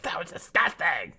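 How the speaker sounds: high-pitched mock disgusted outburst; imitating mad wife. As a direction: act out the wife's revulsion in an altered voice.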